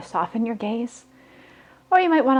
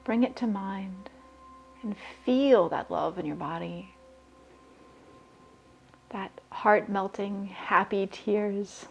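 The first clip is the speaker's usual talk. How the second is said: guided meditation; 9 dB quieter than usual. Acted. [name] guiding listeners to raise their vibration, soft meditation voice. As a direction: breathe the words out calmly, leaving long pauses.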